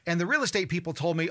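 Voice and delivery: normal talking voice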